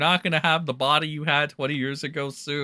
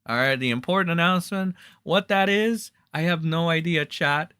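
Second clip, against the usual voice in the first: ordinary delivery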